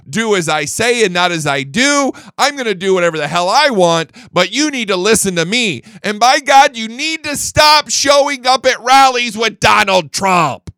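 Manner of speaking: high, loud, building to a shout; mock bossy Democrat. Voicing Democrats scolding voters, he builds to a mock shout. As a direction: play the scolding party boss, ending in a yell.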